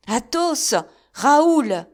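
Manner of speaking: loud, high call from behind; shout. A narrator's reading. D'Artagnan shouting to stop his friends. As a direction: call the two friends from a distance. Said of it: "criait cette voix".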